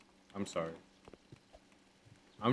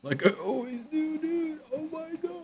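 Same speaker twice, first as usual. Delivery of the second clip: much higher, squeaky put-on voice; silly high voice. Streamer to chat, goofing in a squeaky high voice. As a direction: squeak it out like a cartoon kid.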